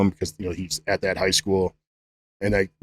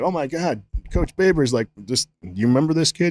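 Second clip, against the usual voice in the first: excited coach; 6 semitones higher than usual. Retold or imitated. Excited coach recounting phone call.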